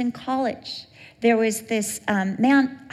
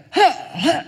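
gasping fright, higher; mock panting; 6 semitones higher, 5 dB louder than usual. Driver after a near crash, mock panting. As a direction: pant it out, startled.

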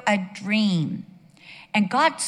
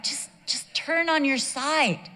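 higher, slow pleading to a sleeper; retold exasperated plea; 6 semitones higher, slower than usual. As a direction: plead slowly, acting out a fed-up wife. Retold nagging of snoring husband.

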